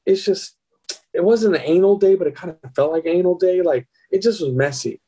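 breezy, matter-of-fact mimicry; nonchalant. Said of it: "who talks like this nonchalantly?".

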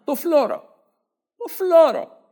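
hammed-up outraged squeal; mock shriek. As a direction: squeal the name in disbelief. Shrill mocking echo.

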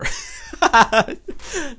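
high squealing laugh. Real, not acted. Laughing about skipping the shower, high squeal.

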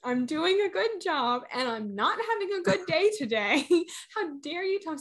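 a retold sob-rant, hammed up; mock bawling. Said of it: "start to bawl".

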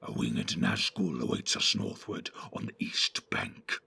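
very low, whispery orc; hushed, evil creature voice. A narrator's reading. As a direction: whisper it with sinister menace. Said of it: "said the evil voice".

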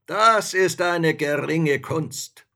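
slow, loud, high boasting; haughty spirit. A narrator's reading. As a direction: draw it out with lofty arrogance. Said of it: "Der Geist sprach voll Hochmut".

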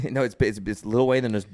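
ordinary delivery

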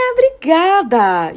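high, loud sugary thank-you; overly sweet gratitude; mock sweet thanks. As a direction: thank him in a syrupy put-on voice. Thanking man after embarrassing question.

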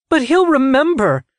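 very high, loud cry; distraught high-pitched wail of words; anguished protest. A narrator's reading. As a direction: cry out in horror for a loved one. Citra horrified for her brother.

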